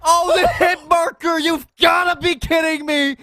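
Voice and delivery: high shout of disbelief; excited shout. Real, not acted. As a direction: yell at the missed kill. Caster shouts at near-clutch.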